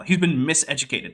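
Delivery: normal talking voice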